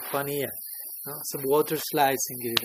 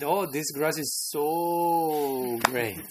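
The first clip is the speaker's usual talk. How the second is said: "so" stretched out extremely long; gush, holding "so" for seconds; ecstatic appreciation; much slower than usual. Acted. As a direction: savour a very long, drawn-out "so". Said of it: "appreciated so much".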